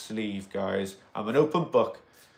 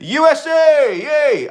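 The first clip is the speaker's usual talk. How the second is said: high, loud, slow cheer for the USA; excited cheer; 13 semitones higher, 8 dB louder, much slower than usual. Real, not acted. Host cheers a viewer from the USA, high and loud. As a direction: whoop it out for the viewer.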